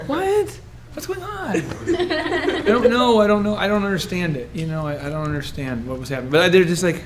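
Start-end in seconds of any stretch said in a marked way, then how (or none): 0.1-1.6 high-pitched confused question; high baffled squeak. Retold or imitated. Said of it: "I'm like". 1.6-6.3 laughing confusion. Retold or imitated. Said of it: "I'm like".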